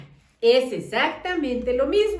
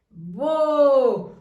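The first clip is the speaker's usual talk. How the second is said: ordinary delivery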